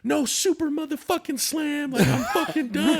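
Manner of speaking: hammed-up angry outburst, laughing; imitating the woman snapping at the caller, high; mock snapping. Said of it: "she snaps".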